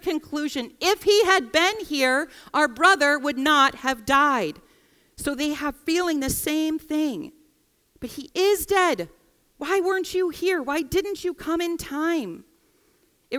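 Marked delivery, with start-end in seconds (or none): 0.8-4.6 slow, higher, louder anguished plea; the sisters voiced in grief, higher and slow; lament. Voicing the sisters' shared conclusion. 8.0-12.4 higher, pained questioning; grieving sister voiced, higher and accusing; hurt reproach.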